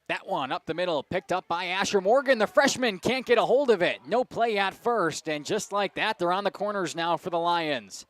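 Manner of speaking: loud, high call peaking mid-play; excited play-by-play. Acted. Announcer calls the freshman's fumble for the listeners, excited play-by-play. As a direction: build with the misplay, then land it.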